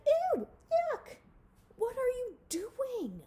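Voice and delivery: high, loud squeal of disgust; disgusted mole rats. A narrator's reading. Mole rats recoil at Wilbur's clothes, loud disgusted squeal. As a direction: shriek it like grossed-out kids.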